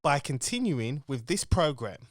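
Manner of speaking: deadpan announcer warning. Acted. Show intro disclaimer read.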